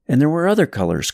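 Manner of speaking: plain reading voice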